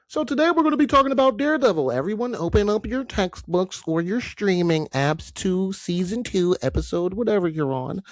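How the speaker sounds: hammed-up teacher telling the class to open textbooks; mock teacher. Host playing a teacher to his listeners, high and fast.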